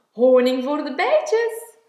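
loud announcement; calling out. A narrator's reading. Groot luring the bees away with honey, calling out. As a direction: shout it out to the bees. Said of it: "riep hij".

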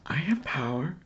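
unvoiced, breathy whisper of pride. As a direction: whisper it like a secret strength. Child whispers to himself.

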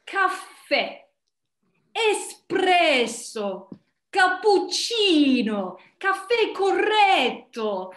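savoured Italian, loud and drawn out; theatrical Italian with long stressed vowels; Italian accent. Shown as a demonstration. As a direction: relish each word in grand, slow Italian. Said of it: "random language like Italian".

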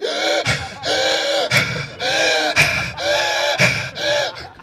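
exaggerated nervous hyperventilation; hyperventilating. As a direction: gasp in and out in a panic. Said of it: "so I'm hyperventilating".

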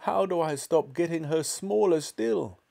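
high, desperate question; crying out. A narrator's reading. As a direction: plead for help. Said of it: "she cried".

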